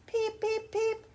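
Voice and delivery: very high, tiny peeps; chick peeps. A narrator's reading. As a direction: peep like newborn chicks. Chicks wake and peep.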